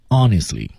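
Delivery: "honestly" stressed, sinking at the end; stressed key word. Shown as a demonstration. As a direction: press on "honestly" and let it fall. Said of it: "关键词加重音向下沉". (重读"honestly"，尾音下沉)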